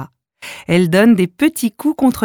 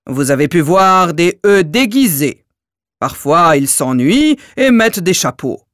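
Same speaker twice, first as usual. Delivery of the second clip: dim giant. Acted. Giant E explains slowly.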